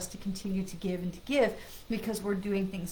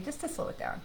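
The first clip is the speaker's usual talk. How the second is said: soft; 5 semitones higher, 8 dB quieter than usual. Shown as a demonstration. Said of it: "reminding you to slow it down".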